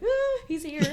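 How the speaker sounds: high startled squeal; panicked squeal. Retold or imitated. Girl hears her date knock at the door, high panicked squeal.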